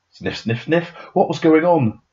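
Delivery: usual speaking voice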